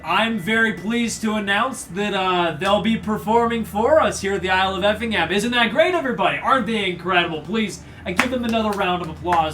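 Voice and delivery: rally the crowd grandly; smug noble playing host; pompous archduke.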